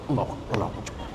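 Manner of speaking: low, quiet, fast mumbled Allahu Akbar; a distracted worshipper's mumbled takbir; hurried mumbled takbir. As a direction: rush through Allahu Akbar under the breath. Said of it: "Yang kau ingat kunci".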